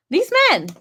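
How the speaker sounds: high, loud exasperated sigh of words. Real, not acted. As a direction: throw up your hands at men. Exasperated about men.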